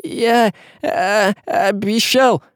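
high, halting, effortful words; strained through clenched teeth. A narrator's reading. Василий struggles under Казимир, strained clenched-teeth voice. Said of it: "стиснув зубы, пробормотал Василий".

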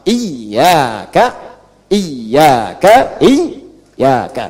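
"iyyaka" starting on i, held double y; read with kasrah. Shown as a demonstration. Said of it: "dibacanya pakai kasrah". ("iyyaka" diawali kasrah, ya bertasydid)

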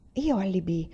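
usual speaking voice

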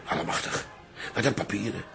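gasping softly at the pile of papers; hushed amazement. Acted. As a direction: breathe it out in disbelief. Old father stunned by papers.

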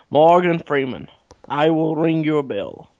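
ordinary delivery

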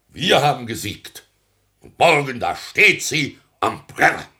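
loud, high triumphant crowing. Acted. Mayor gloats to his family, loud and triumphant. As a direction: trumpet the victory to the family.

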